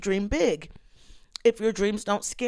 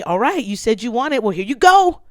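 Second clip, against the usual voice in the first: loud, raised, emphatic retold challenge; the Lord challenging her; 3 semitones higher, 6 dB louder than usual. The Lord answering her prayer, imitated loud challenge. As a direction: throw it down boldly, building louder. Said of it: "the Lord has been kind of saying to me".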